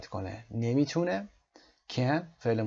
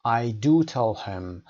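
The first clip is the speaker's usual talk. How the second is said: "do" pressed louder and slow; stressed do; 4 dB louder, much slower than usual. Shown as a demonstration. Teacher stressing "do" for the class. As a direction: lean hard on "do".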